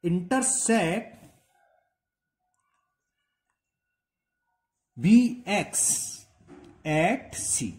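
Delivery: drawn-out dictation with long gaps; slow dictation. Shown as a demonstration. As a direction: dictate slowly for note-taking. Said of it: "तो ये लिख दीजिए".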